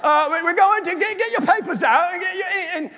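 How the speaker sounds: high, stumbling mock British lecturer; bad British accent. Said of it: "I'll throw out a British accent".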